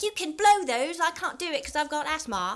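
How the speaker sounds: high, loud mock-Cockney boy; Piggy, Cockney accent. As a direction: whine it in exaggerated Cockney. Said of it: "I don't know why he's Cockney now".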